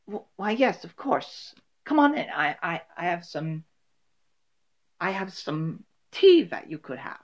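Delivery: a light, fluttery welcome; the host inviting them in for tea; sweet hostess. Acted. Host welcoming the lost friends.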